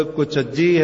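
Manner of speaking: ਕੁਚੱਜੀ as one word with held double J; doubled J. Shown as a demonstration. Preacher correcting everyday scripture readers, ਕੁਚੱਜੀ with doubled J. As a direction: stress the geminate J in ਕੁਚੱਜੀ. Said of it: "ਕੁਚੱਜੀ ਸ਼ਬਦ ਤੇ".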